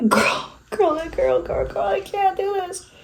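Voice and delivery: high, soft whine of despair; exasperated whine. Real, not acted. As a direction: whine it high and small, giving up. Struggling with lashes, mock despair.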